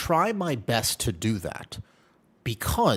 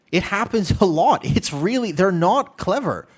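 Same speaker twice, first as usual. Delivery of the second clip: ordinary delivery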